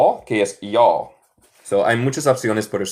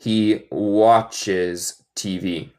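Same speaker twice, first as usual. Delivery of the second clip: slow model sentence; much slower than usual. Shown as a demonstration. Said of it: "teacher repeats model".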